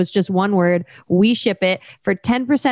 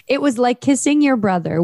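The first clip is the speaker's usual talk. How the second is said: ordinary talk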